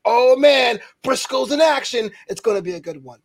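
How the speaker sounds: mock fan hyped to see Briscoe wrestle; excited fan. Podcaster imitating a thrilled crowd member, excited.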